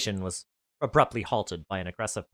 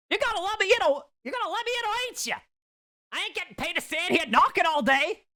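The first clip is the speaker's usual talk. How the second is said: peeved stylist. Acted. Stylist outside the door, impatient peeved voice. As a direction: scold her for the wait. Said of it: "sounded fairly peeved".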